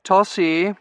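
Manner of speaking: high, reproachful warning; reproving. A narrator's reading. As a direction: scold her with just her name.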